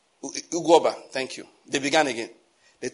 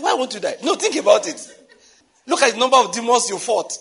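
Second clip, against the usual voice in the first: high, amused disbelief through laughter; laughing retort; 7 semitones higher, 6 dB louder than usual. Retold or imitated. Teasing his sick brother over the demons fought, laughing. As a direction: laugh it out, pitched up and emphatic. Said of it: "I said, laughing".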